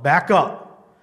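loud, stern warning to step back; stern command. Retold or imitated. Preacher retelling how he ordered a man away, stern. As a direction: order them back, firmly and loudly. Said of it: "That's what I said very sternly".

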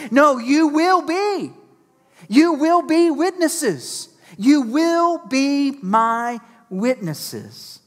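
"will" hit slow and high; stress on will. Acted. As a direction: lean hard on "will" to insist. Preacher insisting you WILL be witnesses.

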